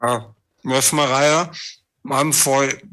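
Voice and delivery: talking through a mouthful; mouth full. Acted. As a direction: talk round a bite of cake. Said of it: "You've got your mouth full".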